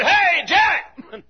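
high, loud greeting call; old acquaintance shouting a greeting after church. Retold or imitated. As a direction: hail an old friend across the room. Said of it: "he said".

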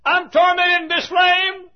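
high, drawn-out preacher's shout; tormented cry. Acted. Rich man crying from hell to Abraham, tormented shout.

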